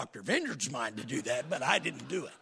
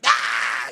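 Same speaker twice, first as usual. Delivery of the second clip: retold scream; 11 dB louder than usual. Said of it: "with a violent, vicious scream".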